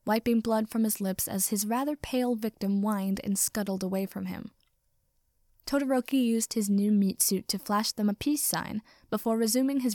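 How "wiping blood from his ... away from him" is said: usual speaking voice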